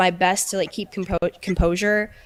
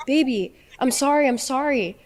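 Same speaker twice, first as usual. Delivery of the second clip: higher, whiny imitation of his sorry; sneering impression of his pleading; mocking the boyfriend's fake apology; mock apologetic boyfriend; 6 semitones higher than usual. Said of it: "and now you're saying".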